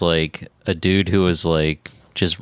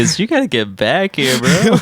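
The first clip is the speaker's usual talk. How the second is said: laughing mock call; 6 semitones higher, 4 dB louder than usual. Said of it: "You're like".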